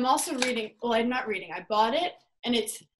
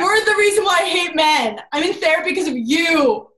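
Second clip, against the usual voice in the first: louder, higher mock-furious rant; retold angry outburst; 5 semitones higher, 7 dB louder than usual. Daughter snaps back at her dad, loud mock-angry rant. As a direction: blow up at him half-jokingly.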